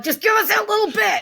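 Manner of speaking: high, pleading cry for a little praise; a parent begging the kids for a scrap of praise, high and pleading; mock pleading parent. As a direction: beg like a needy parent. Parents pleading for kids' approval.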